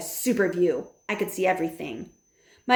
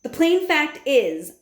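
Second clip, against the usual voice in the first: slow, emphatic opening; deliberate; 8 semitones higher, 6 dB louder, slower than usual. A narrator's reading. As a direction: lay it down slowly. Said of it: "my grandmother answered".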